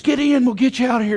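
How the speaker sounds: quick, high rescuer's call; rapid-fire offer of rescue; urgent rescuer. Acted. Voicing the boat's rescuers.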